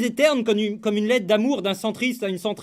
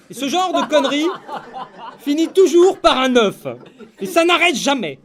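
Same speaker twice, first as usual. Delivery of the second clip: laughing outrage; 4 semitones higher, 4 dB louder than usual. Acted. Laughing escalation.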